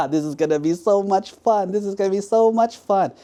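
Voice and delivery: laugh, then crow with mischievous glee; laughing prankster. Acted. Prankster carrying his screaming victim to the edge, laughing. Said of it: "the more he laughed".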